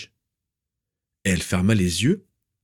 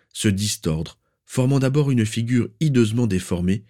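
ordinary delivery, nothing marked

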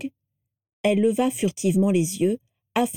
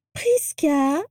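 mocking high, shrill imitation; Prisca Malfoy; 7 semitones higher than usual. A narrator's reading. Vera answers the portrait by copying its voice, shrill mimicry. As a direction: mimic her thin, sharp voice, pitched up. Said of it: "en imitant la voix aigrelette de Prisca".

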